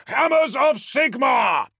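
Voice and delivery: roaring war cry, high and loud. Acted. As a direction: roar it to the heavens, slowly. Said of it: "I roar".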